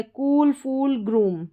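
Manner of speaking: कूल, फूल, ग्रूम with a long "oo"; long "oo" sound. Shown as a demonstration. Teacher closes the example list, long "oo" sound. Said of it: "बड़े ऊ का sound आ रहा है".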